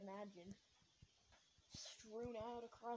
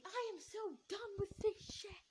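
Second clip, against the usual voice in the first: high, loud furious scream; a fed-up friend screaming, high and loud; 11 semitones higher, 5 dB louder than usual. Retold or imitated. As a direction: blow up in a high, loud yell. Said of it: "he started screaming".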